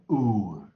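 "or" held long and loud, falling into an r; American R-colored vowel. Shown as a demonstration. Teacher reviews American diphthongs for class, R-colored vowel. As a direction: stretch "or" with an American r. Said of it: "O R我们就念".

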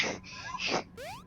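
high, quiet giggle that rises. Real, not acted. Gamer laughing at himself, high quiet giggle. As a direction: let a squeaky laugh slip out.

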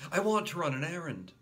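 put-on repeated child's request; eager little boy repeat. A narrator's reading. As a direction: insist again.

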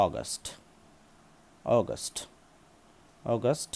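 অগাস্ট said "awgust" three times; correct pronunciation repeated. Shown as a demonstration. Teacher drilling অগাস্ট for learners. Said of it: "কি হবে?".

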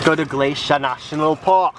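mock-British presenter accent; put-on British accent. Host plugging the park to viewers, put-on British accent. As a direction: plug it in a hammed-up British accent. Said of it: "not the right accent for this land".

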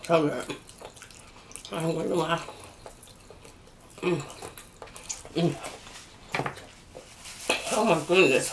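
chewing while talking; muffled through food; mouth full. Real, not acted. Seen 2: Picking chicken, lips pursed, chewing throughout labelled words.

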